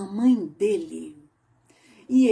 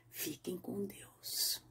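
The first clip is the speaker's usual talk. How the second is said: whispered goodbye; 3 semitones lower, 17 dB quieter, slower than usual. Real, not acted. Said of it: "whispered blessing".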